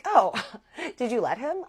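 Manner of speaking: high, giggly mock question; retold reaction with a high laugh; amused friend, imitated. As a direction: imitate a friend asking through a little laugh.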